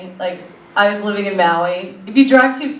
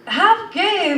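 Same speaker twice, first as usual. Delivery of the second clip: incredulous; 8 semitones higher than usual. Retold or imitated. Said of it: "I'm like".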